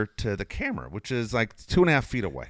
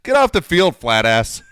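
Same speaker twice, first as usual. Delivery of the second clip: heckling shout; 5 semitones higher, 8 dB louder than usual. Retold or imitated. Retold heckle at old man.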